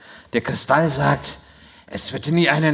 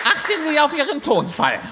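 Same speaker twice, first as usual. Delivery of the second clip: much higher, indignant scolding; high stern rebuke; 12 semitones higher than usual. Acted. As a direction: scold him in a shrill high voice. Wahrsagerin weist zurecht. (hohe, empörte Zurechtweisung)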